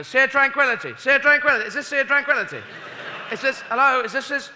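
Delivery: high, loud calling out a window; high loud asking for directions; lost driver asking directions. Acted. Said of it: "wind down the window and go".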